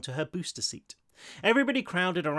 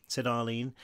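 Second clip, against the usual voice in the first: usual speaking voice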